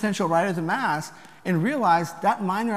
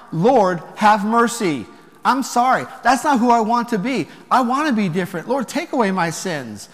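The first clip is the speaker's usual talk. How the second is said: emphatic, heartfelt penitent prayer; from the heart; 3 semitones higher, 5 dB louder than usual. Acted. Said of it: "flows from the heart".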